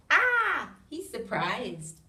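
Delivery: ordinary delivery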